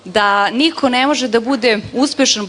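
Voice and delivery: ordinary delivery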